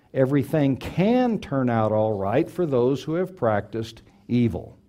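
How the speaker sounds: "can" stretched out and emphasized; stress on CAN. Real, not acted. Preacher restating his emphasized point, stress on "can". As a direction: repeat the point, landing hard on "can". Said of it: "I emphasize the word can".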